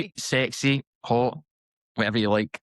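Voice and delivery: put-on Scottish accent; teasing, in a Scottish accent. Shown as a demonstration. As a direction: list them off in a Scots accent. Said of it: "He's such a good accent".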